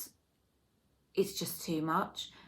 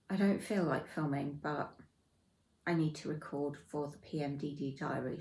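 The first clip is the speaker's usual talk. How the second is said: normal talking voice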